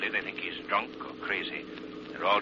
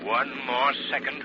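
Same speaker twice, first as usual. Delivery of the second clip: slow, higher and louder, every word weighed; tense countdown; 5 semitones higher, 5 dB louder than usual. Acted. Lamont counting the last second before the island explodes, slow and tense. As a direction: hold the breath before the blast.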